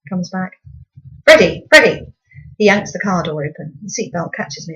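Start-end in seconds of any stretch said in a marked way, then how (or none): 1.3-2.1 loud call across the car park. A narrator's reading. Dad to son left in the car, a loud calling shout. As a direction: shout his name to fetch him.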